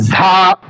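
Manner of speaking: za mispronounced with an added h; wrong jha for za. Shown as a demonstration. Teacher imitating learners' mistake, za wrongly said as jha. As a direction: turn za into a faulty jha. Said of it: "অনেকে আবার উচ্চারণ করে থাকেন".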